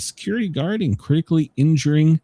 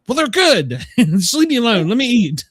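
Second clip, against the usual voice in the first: higher-pitched mock indignation; retold exasperated plea; 7 semitones higher, 5 dB louder than usual. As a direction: protest like someone badgered at dinner. Retold plea to teasing family.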